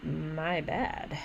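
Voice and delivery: a fake sorry meant to provoke; flat insincere "My bad." picking a fight; cold apology. Shown as a demonstration. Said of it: "and then say coldly".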